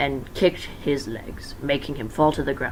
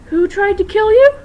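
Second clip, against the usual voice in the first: much higher, loud squeaky voice; squeaky question; 11 dB louder than usual. Acted. High voice character.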